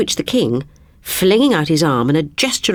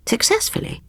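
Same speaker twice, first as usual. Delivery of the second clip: doubtful princess; 4 dB quieter than usual. Acted. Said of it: "asked Lizzie".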